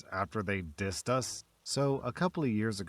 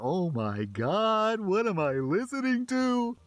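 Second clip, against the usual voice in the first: shocked American; 10 semitones higher, slower than usual. Retold or imitated. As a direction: recoil in disbelief at what you hear. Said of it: "I hear that, I'm like".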